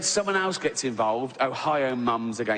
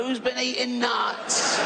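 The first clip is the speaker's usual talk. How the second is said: much higher, slow mock innocence; faux-innocent question; 10 semitones higher, slower than usual. Comic asking the plane who ate nuts, high and slow. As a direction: ask it like you know nothing. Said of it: "and I go".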